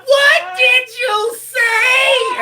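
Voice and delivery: a high, loud, drawn-out yell from far off; mother's shout. Retold or imitated. Mother shouting at her son from the far room. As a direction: call out sharply across the house.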